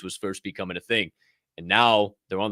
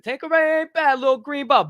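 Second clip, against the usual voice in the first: much higher and louder, lit-up delight; gushing over gin; 6 dB louder than usual. Real, not acted. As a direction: rave about the green bottle. Enthusing over gin brands.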